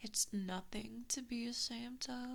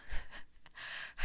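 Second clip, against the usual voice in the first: cruel laugh. Acted. Cruel laugh after threat.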